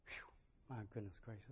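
soft winded sigh; exhale. Real, not acted. Preacher catching his breath after shouting, soft. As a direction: blow out, spent.

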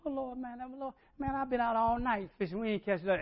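high, pleading complaint; whiny Peter. Retold or imitated. Peter complaining to Jesus, high and pleading. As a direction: whine it up high. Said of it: "Peter answers".

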